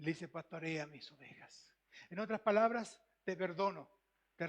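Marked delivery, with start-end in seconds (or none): 0.3-1.7 softer and lower, gently forgiving; tender command. Acted. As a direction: give the charge with tender mercy. Jesus forgives Peter gently. (con ternura, más suave y grave)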